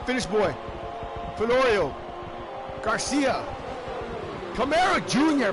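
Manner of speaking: ringing in the winner over the music; live play-by-play. Acted. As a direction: call the finish like a hyped announcer. Calls the race finish live.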